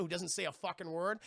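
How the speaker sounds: normal talking voice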